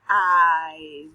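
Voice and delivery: Five stretched out sound by sound; sounding out words. Shown as a demonstration. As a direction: sound out each piece. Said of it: "listen to these".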